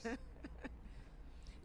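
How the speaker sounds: high, quiet laugh. Real, not acted. Reporter reacting to the anchor's praise of her live report, laughing.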